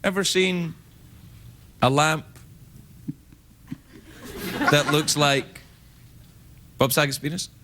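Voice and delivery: long pauses between words; slow halting delivery. Acted. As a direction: drag each phrase out slowly. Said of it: "Why are we talking like this?".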